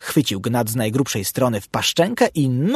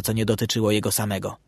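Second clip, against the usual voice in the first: ordinary delivery, nothing marked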